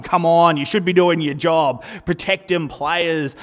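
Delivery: acted-out indignant yelling; fired-up complaint. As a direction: berate the ref from the court. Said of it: "I get all fired up and I start chatting at the referee".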